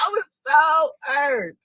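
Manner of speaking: high slow mock sob; hammed-up hurt. Real, not acted. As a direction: whine it like fake crying. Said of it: "I cried on the inside".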